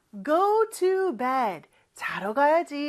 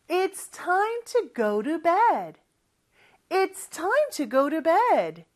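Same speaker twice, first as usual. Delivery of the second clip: slow teaching model; 6 semitones higher, much slower than usual. Shown as a demonstration. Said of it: "영어로는".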